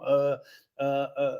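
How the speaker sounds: imitating an awkward excuse-maker; mock hesitant caller; halting talker. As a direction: make the excuse, then dissolve into uhs and ums. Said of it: "this is how this guy talks".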